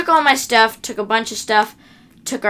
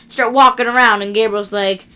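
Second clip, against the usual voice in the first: ordinary talk, nothing marked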